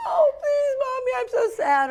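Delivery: loud, very high mock-child pleading; hammed-up kiddie sob story; whining little kid. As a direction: wail it like a child begging Mommy. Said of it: "the little kids who go".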